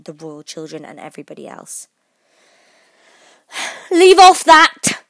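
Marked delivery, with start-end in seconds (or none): 3.9-5.0 high, drawn-out furious command; loud, slow, piercing order; scream. A narrator's reading. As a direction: scream it as an enraged queen. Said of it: "screamed the Queen".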